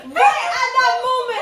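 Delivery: high, loud outburst with a laugh; exasperated outburst. Real, not acted. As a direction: burst out in disbelief at the bad timing. Exasperated at the plot's timing.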